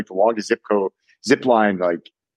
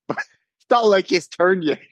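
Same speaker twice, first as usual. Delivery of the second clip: higher, laughing through the words; 4 semitones higher than usual. Real, not acted. Telling friends about a botched bungee jump, laughing. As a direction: struggle to tell it through laughter. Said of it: "I'm sorry, I'm laughing".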